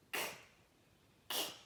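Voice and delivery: K as a bare voiceless click "k"; just the "k" burst, no vowel; isolated K sound. Shown as a demonstration. Teacher showing what sound K has, a short bare "k". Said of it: "K tem o som de".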